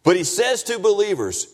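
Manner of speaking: high, insistent preacher's voice; impassioned preaching. Real, not acted. Preaches at full force.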